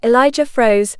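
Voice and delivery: ordinary delivery, nothing marked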